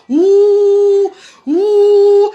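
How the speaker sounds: loud drawn-out booing ouh; mock booing. Leading the girls in booing irresponsible men, loud ouh. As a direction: boo them like a jeering crowd.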